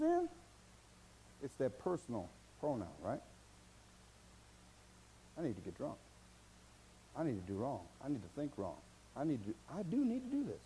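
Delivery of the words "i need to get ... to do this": quiet inner craving. Acted. The sinful thought voiced.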